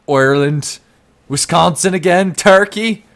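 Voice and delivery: high, loud excited squeal; excited yelp. Real, not acted. Streamer squealing at viewer locations in chat, excited. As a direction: yelp the place names out.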